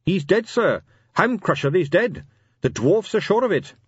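urgent report. Acted. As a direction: press the grave news on your superior. Carrot insists on grave news.

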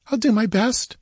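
high, breathless frightened reply; gasping. A narrator's reading. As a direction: gasp it out, frightened. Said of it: "she gasped".

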